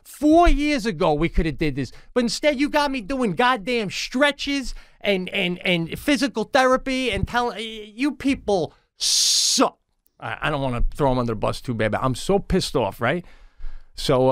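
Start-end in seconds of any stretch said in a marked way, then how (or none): 0.0-9.8 high, heated rant at the doctor; angry rant. Retold or imitated. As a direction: let the anger build and spit it out.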